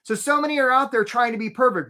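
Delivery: ordinary talk, nothing marked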